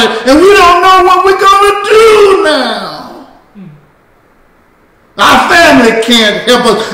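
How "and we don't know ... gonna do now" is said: high, ringing cry of despair; preacher's anguished high cry; preaching climax. Acted. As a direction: lift it into a wail of helplessness.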